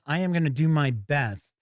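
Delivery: normal talking voice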